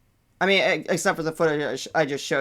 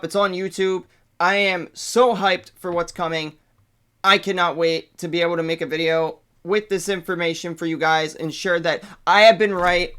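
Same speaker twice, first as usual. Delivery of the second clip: ordinary delivery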